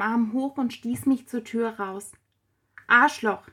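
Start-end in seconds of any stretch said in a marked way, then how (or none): 2.9-3.5 a mildly angry, louder exclamation; understated shout, louder than the narration. A narrator's reading. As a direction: throw the insult out with a touch of anger. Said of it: "schrie ich ihm wütend hinterher".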